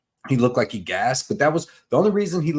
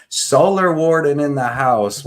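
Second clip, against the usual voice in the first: hype shout-out; 4 semitones higher, 6 dB louder, slower than usual. Real, not acted.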